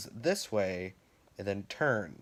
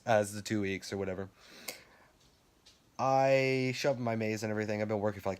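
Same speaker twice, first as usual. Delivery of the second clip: ordinary delivery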